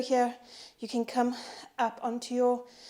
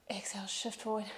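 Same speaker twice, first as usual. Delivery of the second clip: whispered cue; 10 dB quieter than usual. Real, not acted. Breath cue during exercise.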